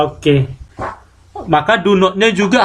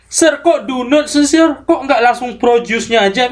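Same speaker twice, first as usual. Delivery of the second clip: imitating a student; 5 semitones higher than usual. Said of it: "Ada yang bertanya seperti itu".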